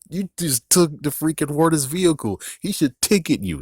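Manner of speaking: high-pitched indignant scolding; mock outrage. Mock outrage at taking vehicle.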